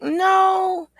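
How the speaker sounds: high kiddie refusal; imitated little child. Child refusing Queen's suggestion, high imitated voice. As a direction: mimic a child saying no. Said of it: "She's like".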